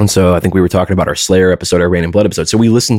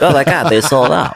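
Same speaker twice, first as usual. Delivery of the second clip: laughing mock fan; 5 semitones higher than usual. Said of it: "They're like".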